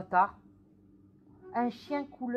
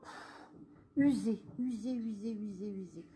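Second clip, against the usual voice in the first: worn out, fading; 3 semitones lower, 8 dB quieter than usual. Retold or imitated. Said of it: "on me dit".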